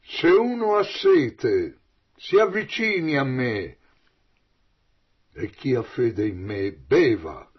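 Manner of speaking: grido forte e lento, alto all'inizio; a voce alta. Acted. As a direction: chiama forte chi ha sete. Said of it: "esclamò ad voce alta". (invito gridato alla folla)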